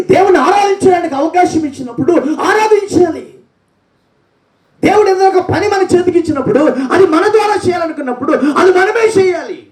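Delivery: high, fervent preacher's cry; preaching at full force. Acted. Preacher exhorting the congregation to praise, high. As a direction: drive it home to the church.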